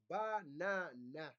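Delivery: "Banana" slowly split as ba, na, na; syllable by syllable. Shown as a demonstration. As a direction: stretch each syllable of "Banana": ba, na, na. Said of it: "has actually three syllables".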